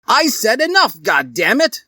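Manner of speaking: loud, high furious shout. Acted. Umbridge yelling at the kissing students, furious shout. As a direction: blow up at them. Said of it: "shouted the professor".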